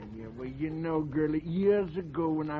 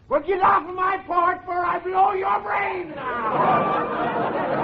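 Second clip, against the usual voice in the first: higher and much louder, bellowing; furious shout; 9 dB louder, slower than usual. Acted. Old man bellows at the saleswoman to leave his porch. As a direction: roar the threat to chase her off.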